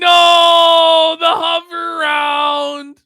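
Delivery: high, loud, long-held fake dismay; mock wail. Host reacting to the hover round cart, mock wail. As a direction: wail it slowly in hammed-up horror.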